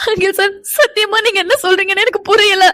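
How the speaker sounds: tearful, bewildered protest; crying. Acted. Woman insisting she doesn't understand sir, crying. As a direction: swear it through tears. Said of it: "நீயா அழுதுட்டு இருக்காத".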